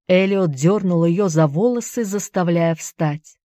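ordinary delivery, nothing marked

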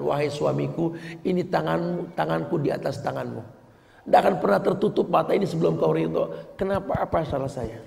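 a soft, devoted wife pleading; tender plea to husband. Acted. Wife pleads to her husband for his approval, tenderly. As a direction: hold his hand and plead softly. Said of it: "dia pegang tangan mengatakan".

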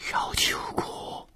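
slow, barely audible horrified whisper; shocked whisper. Acted. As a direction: breathe it out in stunned disbelief. Shocked at a dead woman's name. (震惊地低声耳语)

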